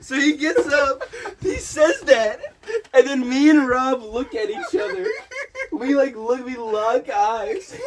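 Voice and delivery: high, loud, tearful laughing voice; breaking up, squeaky with laughter; laughing too hard. Real, not acted. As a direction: struggle to tell the story through laughter. Telling story while cracking up.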